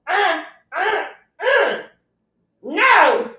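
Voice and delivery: high, loud startled uh-uh into a no; mock pained protest. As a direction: act out a woman flinching. Woman reacting to pain.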